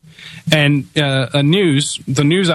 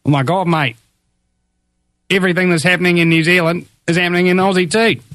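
put-on Australian accent; 5 semitones higher, faster than usual. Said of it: "the Australians will listen".

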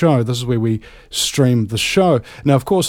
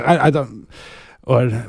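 ordinary talk, nothing marked

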